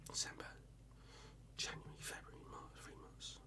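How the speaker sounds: barely audible whisper; counting to oneself. Real, not acted. Tallying months to himself, whispered. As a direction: whisper it under your breath while working it out.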